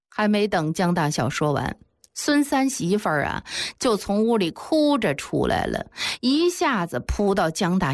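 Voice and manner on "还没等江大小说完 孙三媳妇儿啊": ordinary delivery, nothing marked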